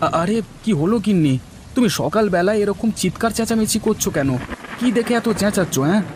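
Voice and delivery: high, flustered demand to know; startled questioning. Acted. Husband roused by his wife's shouting, startled questioning.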